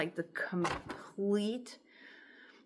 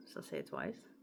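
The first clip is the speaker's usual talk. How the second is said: muttered aside; 10 dB quieter than usual. Real, not acted. Aside while reading lyrics.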